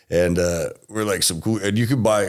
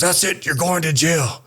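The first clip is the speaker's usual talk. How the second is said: higher-pitched cop threatening arrest; Mexican policeman; 8 semitones higher, slower than usual. Retold or imitated. Policeman threatening the kid with jail, high-pitched verdict. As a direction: announce the arrest in a raised pitch. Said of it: "He goes".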